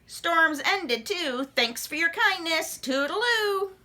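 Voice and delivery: high chirpy frog goodbye. Acted. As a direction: sing out a breezy farewell. Froggy leaving.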